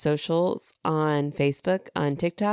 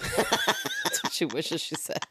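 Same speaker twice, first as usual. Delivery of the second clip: squealed through laughter, much higher and slow; laughing comeback; 6 dB quieter, slower than usual. Real, not acted. Firing back at her cohost's joke, laughing hard. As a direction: laugh the punchline out.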